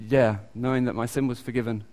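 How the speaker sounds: ordinary talk, nothing marked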